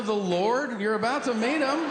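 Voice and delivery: high, snippy comeback; high mocking reply. Son snapping back at his father, high and mocking. Comic answering dying father.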